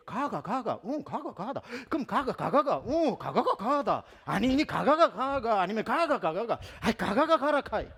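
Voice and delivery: loud, high, laughing Gyeongsang chant of 가가; Gyeongsang dialect. Retold or imitated. Aunts arguing over 가가, loud laughing Gyeongsang dialect. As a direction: rattle off 가가가 in exaggerated Gyeongsang dialect. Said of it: "이게 경상도 말이거든".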